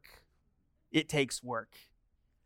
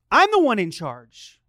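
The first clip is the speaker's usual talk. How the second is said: loud, insistent dad; acted parental outburst, punched from the start; forceful authority; 6 dB louder than usual. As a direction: raise your voice to claim command. Dad asserting authority, forceful take.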